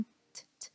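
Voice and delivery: t as a bare voiceless consonant; just the unvoiced tick of the letter t; voiceless t sound. Shown as a demonstration. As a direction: release t with no vowel or voicing. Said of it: "là một cái âm vô thanh".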